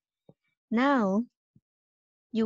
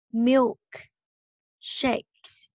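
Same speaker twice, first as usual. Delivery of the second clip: Milk, clear pause, Shakes; slow, split syllables; 2 semitones higher than usual. Shown as a demonstration. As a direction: sound out Milk and Shakes one at a time. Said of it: "mình đọc nhanh hơn một chút nha".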